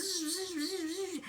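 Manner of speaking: high, soft buzzing of a power saw; mimicked tool whine, high and soft; imitated saw buzz. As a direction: buzz like a little workshop saw. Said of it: "I heard zzz".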